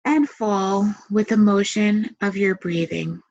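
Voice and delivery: calm, measured meditation voice with long pauses; guided meditation. Acted. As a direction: guide them gently into relaxation, leaving space to breathe.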